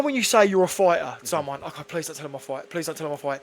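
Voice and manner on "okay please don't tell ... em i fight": hushed plea. Retold or imitated. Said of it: "do you know what I say".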